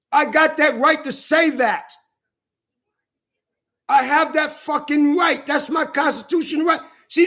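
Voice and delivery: heated, high-pitched protest; indignant insistence. Real, not acted. Man disputing the charges against him, indignant insistence. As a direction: insist on your rights in real outrage. Angry rant insisting on his rights.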